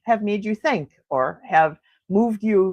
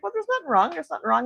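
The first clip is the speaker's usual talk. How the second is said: lightly voiced higher protest; mildly mimicked dismissal, pitched up; retold protest; 8 semitones higher, faster than usual. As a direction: imitate someone insisting he is fine. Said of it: "He's like".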